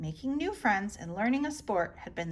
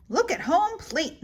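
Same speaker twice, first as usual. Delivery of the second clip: ordinary delivery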